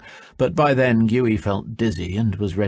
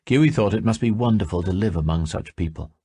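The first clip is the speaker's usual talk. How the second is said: ordinary delivery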